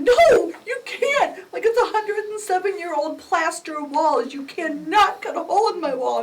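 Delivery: high, loud, outraged; indignant protest. Retold or imitated. Homeowner to officer about her wall, indignant. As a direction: refuse loudly, appalled.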